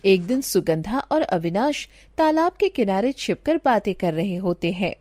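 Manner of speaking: usual speaking voice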